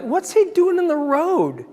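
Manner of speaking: high, slow, puzzled alarm; as if a shape just stepped out; startled question. Retold or imitated. Driver seeing a man in the dark road, high and startled.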